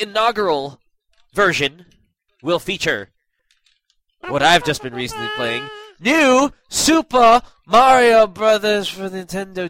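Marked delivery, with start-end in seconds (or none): 6.0-9.7 loud, high, slow showman announcement; grand announcer voice. Pretend. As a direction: announce it like a game show host. Announcing the episode's game.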